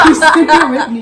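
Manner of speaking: loud, high words through laughter; laughing hard. Real, not acted. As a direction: shout it out while cracking up. Both still laughing.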